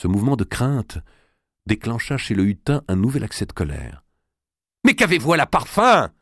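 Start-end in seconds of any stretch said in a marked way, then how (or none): 4.8-6.1 high, loud exasperated outburst; angry cry. Acted. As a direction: cry it out in fury. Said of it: "s'écria-t-il". (il s'écrie, furieux et aigu)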